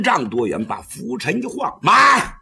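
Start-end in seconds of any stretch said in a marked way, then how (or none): 1.8-2.4 loud, higher shout to halt; the old Taoist halts the girl mid-fight; commanding shout. Acted. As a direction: stop them with one loud word.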